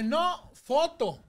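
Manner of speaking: high, slow, loud insisting; insistent correction. Retold or imitated. Groom slowly insisting on a photo, not a video. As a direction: spell it out word by word.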